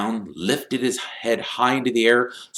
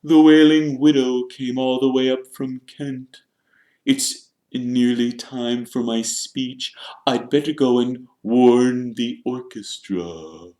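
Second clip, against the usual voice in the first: proud Nearly Headless Nick; 5 semitones higher than usual. A narrator's reading. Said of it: "Nearly Headless Nick proudly".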